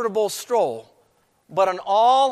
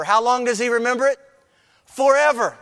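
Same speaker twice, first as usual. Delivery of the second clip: higher, louder call and answer; emphatic preaching; 5 semitones higher, 4 dB louder than usual. Acted. Preacher proclaiming God remembers forever, emphatic. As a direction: ring out the answer.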